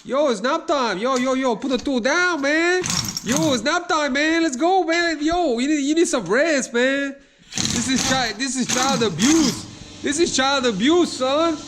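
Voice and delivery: squeaky hype-man pushing for nap time; hyped bro voice. Acted. Dad playing hyped bro.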